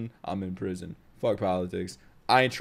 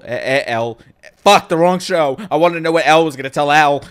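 loud flustered yell; shouting; 6 semitones higher, 12 dB louder than usual. Real, not acted. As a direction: correct yourself at full volume. Flustered yelling, mixing up shows.

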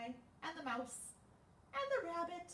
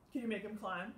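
plain reading voice

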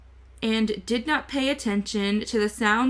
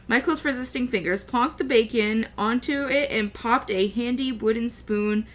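ordinary delivery, nothing marked

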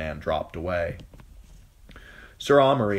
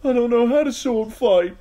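scared; 9 dB louder than usual. A narrator's reading. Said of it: "wide-eyed".

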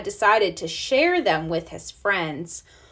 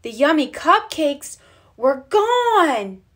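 higher and slow, aghast; shocked discovery; 6 semitones higher, 3 dB louder than usual. A narrator's reading. Reading the moment the cupcakes vanish, dismayed. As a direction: land the shock of the empty plate. Bear finds the cupcakes gone.

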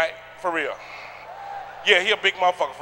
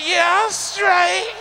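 slow drawn-out reply; 7 semitones higher, much slower than usual. Retold or imitated. Big eater answers mid-hum.

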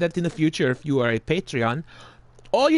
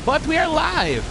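much higher and louder, like a ring announcer; hype announcer; 12 semitones higher, 4 dB louder than usual. Pretend. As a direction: announce it to the arena. Stream host hypes the show.

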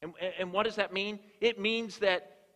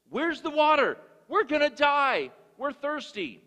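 much higher, louder whiny complaint; complaining Israelites; 9 semitones higher, 5 dB louder than usual. Retold or imitated. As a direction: whine at Moses like thirsty complainers. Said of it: "They begin to complain to Moses".

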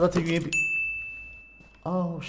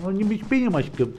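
cooing to pet; 4 dB quieter than usual. Real, not acted. Coaxing pet affectionately.

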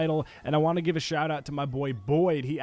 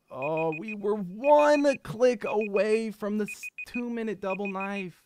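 high, slow mournful groan; real regret at the near miss; mourning the lost double knife; lament; 7 semitones higher, slower than usual. Real, not acted.

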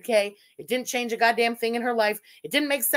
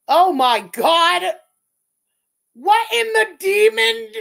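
shocked outcry; 7 semitones higher, 10 dB louder, slower than usual. Real, not acted. Shocked by a gross screenshot.

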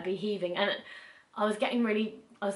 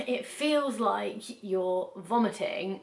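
ordinary delivery

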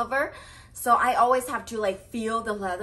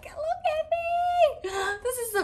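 delighted squeal. Real, not acted. Showing off outfit with bag.

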